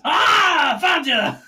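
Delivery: loud high shout right into their ears; sudden scream. Acted. Sneaking dwarf shouts into the party's ears, loud and high. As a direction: jump out with a yell. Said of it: "almost screams right into their ears".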